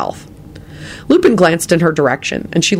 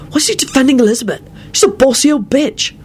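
high, indignant youngster; child's voice; 10 semitones higher than usual. A narrator's reading. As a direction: complain to your father in a high voice. Child keeps questioning father.

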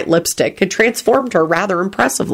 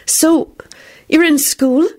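high, hasty question; hasty small talk; 10 semitones higher, slower than usual. A narrator's reading. Beatrice searches for child talk, hastily. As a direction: grab at a question to fill the gap. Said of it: "she uttered hastily".

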